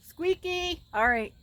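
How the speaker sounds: high, loud call; calling a pet. Real, not acted. Owner calling Squeaky for dinner, high calling.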